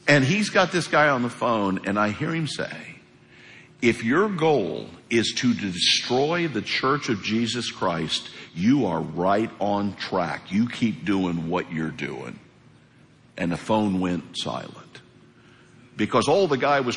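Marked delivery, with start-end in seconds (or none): 3.8-12.4 low, slow, measured rebuke; quietly deliberate, dropping lower as it goes; laid-back firmness. Retold or imitated. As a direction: imitate a nice man calmly standing firm. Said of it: "Cord's really laid back".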